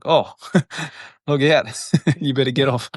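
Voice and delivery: warning delivered through chuckles; laughing tease. Retold or imitated. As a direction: tease him while laughing. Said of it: "he was like".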